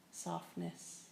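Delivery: usual speaking voice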